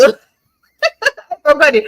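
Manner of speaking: laughing through the words. Real, not acted. Laughing at own forgetting.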